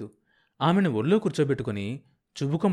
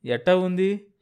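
raised, anxious question; worried asking; 7 semitones higher, 3 dB louder, slower than usual. Acted. Husband asking his fainted wife how she is, in worry. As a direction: ask her gently but fearfully. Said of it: "దిగులుగా అన్నాడు".

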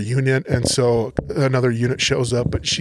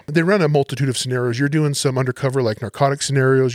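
usual speaking voice

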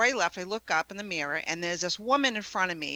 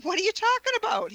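high-pitched, incredulous question; retold bewilderment, pitched way up; baffled; 14 semitones higher, 4 dB louder, faster than usual. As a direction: act out innocent disbelief. Retelling her baffled reply to husband.

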